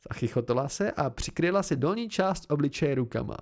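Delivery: ordinary delivery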